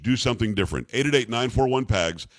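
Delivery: usual speaking voice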